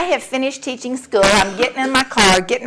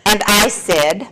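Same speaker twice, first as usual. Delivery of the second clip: ordinary delivery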